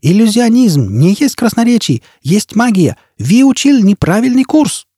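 put-on high foreign-accented scolding; high, indignant cry with a foreign accent; exasperated shouting. A narrator's reading. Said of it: "закричал лохматый".

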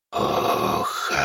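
low, breathy, drawn-out whispery creature voice; rustling ghoul. A narrator's reading. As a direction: force out human speech slowly and softly. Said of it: "прошелестел с той стороны зала голос". (шелестящий голос гуля, медленно, с трудом)

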